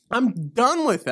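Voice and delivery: ordinary talk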